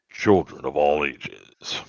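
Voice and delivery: low, slow showman voice; mock announcer. Host signing off to listeners, low announcer voice. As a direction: draw it out deep like a ringmaster.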